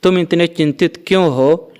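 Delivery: ordinary talk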